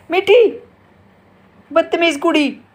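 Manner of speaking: high, loud squawky parrot voice; parrot imitation. Mother showing how her parrot will squawk it back. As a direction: mimic the parrot repeating it. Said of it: "अब देखना ज़ोर से बोलेगी".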